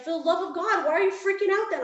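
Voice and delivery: imitated husband, high and loud disbelief; exasperated outburst. Husband baffled by his crying wife's good news, exasperated. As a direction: throw it out in mock exasperation, pitch way up.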